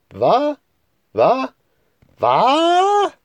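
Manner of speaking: three jaws dropping at once; stunned disbelief. Acted. Old men stunned by vanishing car.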